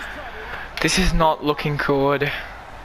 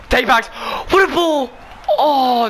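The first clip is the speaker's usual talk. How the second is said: excited shout; 7 semitones higher than usual. Real, not acted. Gamer amazed by his team's pass, excited cry. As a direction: gasp then shout in delight.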